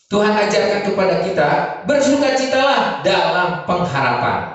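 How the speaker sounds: loud, emphatic sermon delivery; preaching at full force. Real, not acted. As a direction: drive the teaching home loudly to the congregation. (berkhotbah dengan suara lantang)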